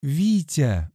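high, pleading call; imploring. A narrator's reading. Woman appeals to her angry man, imploring. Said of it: "взмолилась Ольга".

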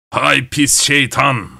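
put-on slow, grumbling old man's oath; grumbled curse. Grandfather cursing the devil who froze his legs, slow grumble. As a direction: mutter it like a cross old man spitting out a curse. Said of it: "diye söylendi".

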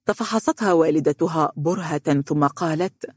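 plain reading voice

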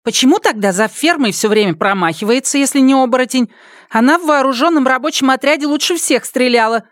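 high, pressing village woman; insistent worker. A narrator's reading. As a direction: keep pushing the point. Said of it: "не унималась работница".

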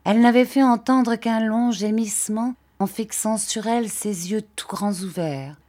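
ordinary delivery, nothing marked

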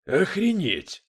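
high, loud burst of amazement; astonished exclamation. A narrator's reading. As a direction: exclaim it, stunned. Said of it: "воскликнул Дэвид".

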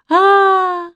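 long high loud wail; drawn-out crying "aah"; bawling. A narrator's reading. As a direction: bawl like a frightened child. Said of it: "ревела Манечка".

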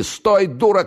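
very high, stretched cry; alarmed, high-pitched shout; urgent shout. A narrator's reading. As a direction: yell after a man to stop him. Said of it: "крикнул ему вслед Октябрьский".

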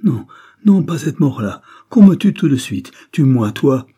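loud, high-pitched desperate begging; frantic pleading. A narrator's reading. Terrified bandit begs to be killed. (supplication affolée, voix forte et aiguë)

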